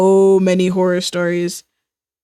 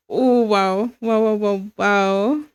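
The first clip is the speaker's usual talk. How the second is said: normal talking voice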